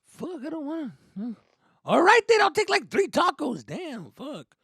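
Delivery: high grudging voice; high reluctant teen; teen caving in. Retold or imitated. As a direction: give in to hunger grumbling.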